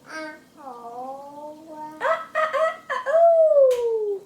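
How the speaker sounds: high, loud crow through a mouthful of carrot; rooster crow with mouth full. Real, not acted. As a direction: crow like a rooster while chewing. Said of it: "It's hard to make rooster sounds in your mouth full of carrot".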